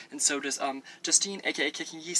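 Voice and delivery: ordinary talk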